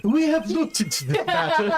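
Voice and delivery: high, theatrical flamboyant delivery; showy, hammed-up flamboyant voice. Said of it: "let me talk in a flamboyant voice".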